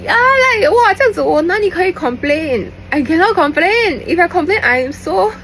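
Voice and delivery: high, loud gushing squeal; giddy excited. Real, not acted. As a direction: gush about him like a giddy fan. Giddy high squealing over his looks.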